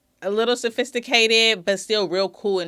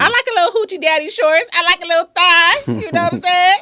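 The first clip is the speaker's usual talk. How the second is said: higher, louder teasing voice; playful; 10 semitones higher, 6 dB louder than usual. Real, not acted. Woman teasing a friend about short shorts, high playful. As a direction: tease him with a high playful lilt.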